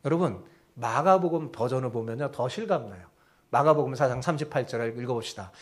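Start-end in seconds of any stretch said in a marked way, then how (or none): none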